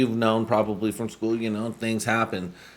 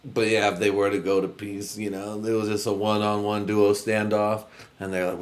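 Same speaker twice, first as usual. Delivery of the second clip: ordinary delivery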